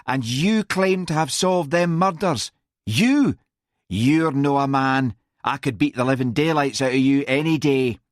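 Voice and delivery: high, scornful challenge; sneering. A narrator's reading. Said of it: "sneered Mary".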